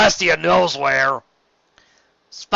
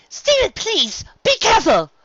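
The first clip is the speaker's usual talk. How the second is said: very high pleading cry; frantic plea; 6 dB louder than usual. Acted. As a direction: beg in panic. Rarity pleading in peril.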